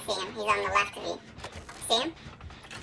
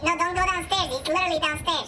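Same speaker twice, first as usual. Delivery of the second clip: raised, insistent voice; alarmed, higher-pitched plea; urgent warning; 6 semitones higher than usual. Real, not acted. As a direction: stop your friend before they walk into danger. Warns friend away from killer.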